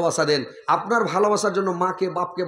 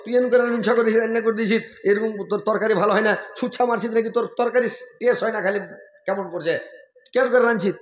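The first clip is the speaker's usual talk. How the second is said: mock scolding husband; 5 semitones higher than usual. Husband insulting wife's curry, raised scolding tone. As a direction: berate the cooking in a raised voice. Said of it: "স্ত্রী বলে".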